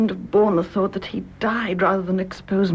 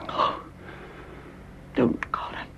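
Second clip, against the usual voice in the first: whispered plea; 8 dB quieter than usual. Acted. She stops his confession.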